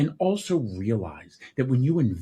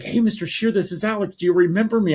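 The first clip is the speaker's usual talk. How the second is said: put-on much higher eager teen voice; young Alex; 11 semitones higher, 5 dB louder than usual. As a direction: play the boy calling up, high and hopeful. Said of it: "he was like".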